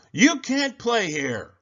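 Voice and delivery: high, slow, loud bully shout. A narrator's reading. Bully Sammy shouting Kevin off the monkey bars. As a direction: shout him off your turf. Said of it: "Sammy shouted".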